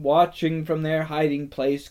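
usual speaking voice

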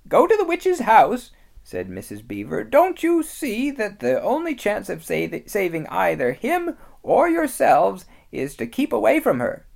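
high, loud motherly beaver voice; lightly voiced anxious mother beaver; Missus Beaver. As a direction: scold the children urgently.

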